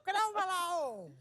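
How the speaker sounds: put-on high, slow cranky old woman yell; angry old lady voice. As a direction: yell it like a grumpy grandma.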